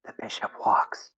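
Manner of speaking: whispered read-through of a note; reading to oneself. Acted. Stella reads the last part, whispering to herself. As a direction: whisper it, puzzling over the words. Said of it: "looks at the last part and goes".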